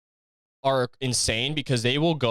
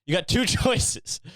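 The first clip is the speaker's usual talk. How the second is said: laughing mid-rant; 8 semitones higher, slower than usual. Pretend. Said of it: "playing fucking David Goggins audio".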